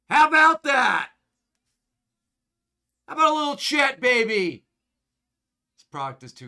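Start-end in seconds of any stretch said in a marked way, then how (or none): 0.1-4.6 loud, high hype shout; excited shout. Real, not acted. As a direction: yell it out celebrating the pull. Celebrating the big hit.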